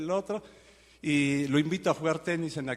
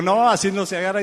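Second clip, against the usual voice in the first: correcting; 6 semitones higher, 9 dB louder than usual. Retold or imitated. Correcting his tennis grip.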